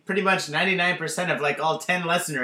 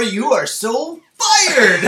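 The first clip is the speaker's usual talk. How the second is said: slow, loud, high drawn-out firing; boss firing you; 6 semitones higher, 6 dB louder, much slower than usual. Pretend. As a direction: stretch out the firing like a smug boss.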